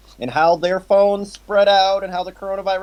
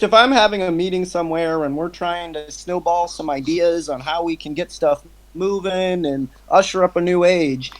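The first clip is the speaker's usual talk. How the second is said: ordinary delivery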